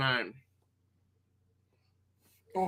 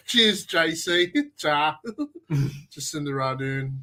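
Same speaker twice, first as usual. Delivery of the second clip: ordinary delivery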